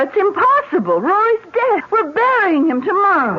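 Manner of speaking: high, stunned, on the edge of panic; shocked disbelief. Acted. Wife to husband on learning the dead driver returned, shocked high voice. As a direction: blurt it out as if the world just tilted.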